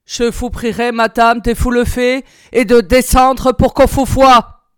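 brusque tone. A narrator's reading. Prussian officer orders the madwoman downstairs, curt and loud. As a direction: bark the order at the bedridden woman. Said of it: "d'un ton brusque".

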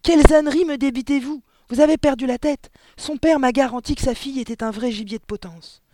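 loud, high-pitched outraged rant; furious howling. A narrator's reading. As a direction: tear into the teacher at full volume. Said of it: "hurla-t-elle".

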